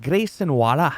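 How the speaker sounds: mock Australian accent, lifted and punchy. Shown as a demonstration. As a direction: do a demo Australian accent with dropped R's. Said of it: "I can do, like, an Australian accent".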